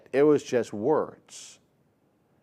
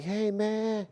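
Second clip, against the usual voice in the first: high put-on kid voice, laid back; imitating a teen; 9 semitones higher, much slower than usual. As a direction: play the cool teenager, much higher. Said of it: "They'd be like".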